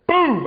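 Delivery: sudden loud, high BOOM; imitated boom. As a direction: burst it out like a crash. Imitating the car part dropping.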